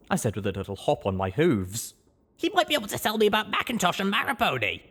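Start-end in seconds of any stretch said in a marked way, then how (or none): 2.4-4.8 higher, fast excited chatter; eager young mare. Acted. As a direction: rush it out eagerly. Blackjack eager.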